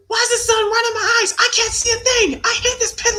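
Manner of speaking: very high, loud squeaky hamster squeal. Pretend. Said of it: "Hamster impression for the sun".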